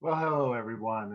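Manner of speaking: low, hushed mock-seductive greeting; sexy voice. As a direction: breathe it out like a cheesy lover. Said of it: "talking like this in my sexy voice".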